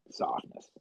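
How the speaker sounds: breathy whisper, barely there. Retold or imitated. The parent, going quiet, in a whisper. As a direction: let the word vanish in a whisper.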